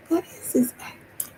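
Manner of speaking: hushed whisper, slowed; whispering while she spots him; retold whisper. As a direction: whisper it like a secret discovery. Recognizing someone, speaking secretly.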